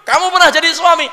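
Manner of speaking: high, loud shouted call; commanding summons. Acted. God calling up a husband on Judgement Day, commanding shout.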